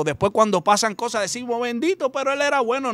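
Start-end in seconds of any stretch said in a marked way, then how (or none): 1.6-2.9 high, pleading lament of a parent; a parent's high wail that he was good; mock lament. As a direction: plead his innocence in a higher voice. Parents lamenting after the tragedy.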